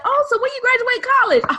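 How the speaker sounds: high, quick questioning; imitated curious stranger. Acts out people asking her age.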